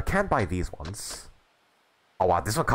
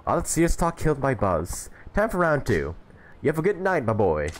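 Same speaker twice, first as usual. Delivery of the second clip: put-on higher-pitched old-timer signing off; jolly old drinker; 6 semitones higher than usual. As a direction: play a merry old drunk saying goodnight. Voices old drinker taking his leave.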